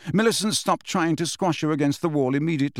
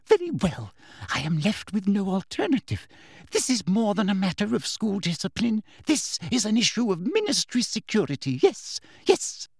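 higher, prim official, ending in a whispered yes, yes; Umbridge's voice; 7 semitones higher than usual. A narrator's reading. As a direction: talk yourself into it, sweetly tense.

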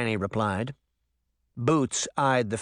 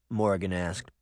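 usual speaking voice

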